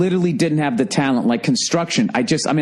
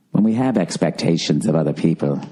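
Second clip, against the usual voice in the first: mock the British meditation narrator, much lower; soothing British accent; 8 semitones lower than usual. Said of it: "soothing voice like this, some sort of British accent".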